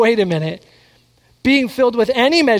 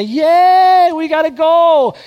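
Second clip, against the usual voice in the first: mock lukewarm cheer; 8 semitones higher, 6 dB louder, much slower than usual. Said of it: "everybody else is just kinda".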